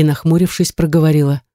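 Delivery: plain reading voice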